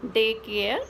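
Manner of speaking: usual speaking voice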